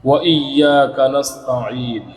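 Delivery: slow, drawn-out tajweed chant; slow melodic Quran recitation. Shown as a demonstration. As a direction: recite each verse slowly with long drawn vowels. Recites Quran verses as examples.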